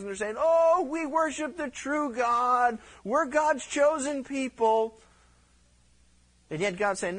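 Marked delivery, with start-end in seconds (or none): 0.4-5.0 high, slow, sanctimonious put-on voice; mock pious worshippers. Preacher mocking Israel's worshippers: high slow smug piety. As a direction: ham up smug false piety.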